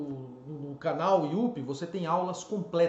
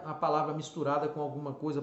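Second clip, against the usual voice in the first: ordinary talk, nothing marked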